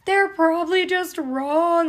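imitating her panicked denial to her mom; retold anxious wail. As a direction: insist it through fake tears. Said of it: "such a negative Nancy".

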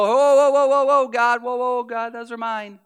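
mock protest. A believer clutching their fries, mildly alarmed. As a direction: protest to God, then trail off. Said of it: "when you start to go".

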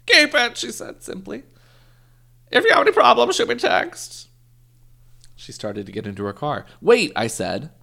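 high, loud, hammed-up old lady. Story clip: the lady answers the narrator in a loud, high hammed-up voice. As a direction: play the old lady selling happiness in jars.

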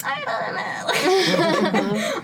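high, loud, dragged out I don't know with a laugh; mock helpless I don't know. Mocking herself for knowing no Italian, laughing. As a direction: whine it out, laughing at yourself. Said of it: "and then I am like".

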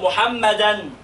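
MUHAMMADAN, slow with drawn-out "-dan"; tanween fath. Shown as a demonstration. Teacher shows the third ending to pupils, slow MUHAMMADAN. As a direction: hold the final N of MUHAMMADAN. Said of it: "إيه المشترك بين التلاتة دول".

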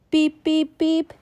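sing-song little truck beeping; a touch of cheerful truck. Acted. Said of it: "truck beeps in story".

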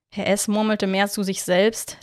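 plain reading voice

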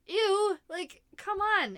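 high, loud grossed-out ew, then a drawn-out come on; disgusted ew. Retold or imitated. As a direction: recoil at the soggy burger. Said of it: "And I'm like".

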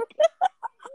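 a burst of high laughter; giggly high laugh; high squealing laugh. Real, not acted. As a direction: squeal with delight at the tease. Laughing at friend being soft.

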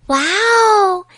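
put-on light up with childlike wonder; delighted wow. 小苹果惊喜. (小苹果惊喜地大叫)

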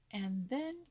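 ordinary delivery

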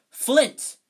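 very loud, high bellow; captain bellowing at the Slytherin captain. Acted. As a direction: roar the name across the pitch. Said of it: "Wood bellowed".

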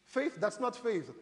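ordinary talk, nothing marked

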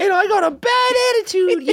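very high squeaky Aussie; mock Australian accent. Co-host joining the impression of the listener, squeaky mock Australian. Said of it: "that's what this guy sounds like".

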